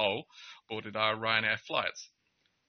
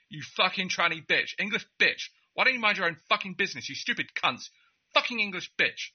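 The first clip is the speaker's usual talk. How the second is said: mock furious rant; 8 semitones higher than usual. Angry passenger yelling at the narrator at customs, imitated furious rant. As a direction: play an enraged stranger losing it, spitting insults. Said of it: "he just lost all of his marbles".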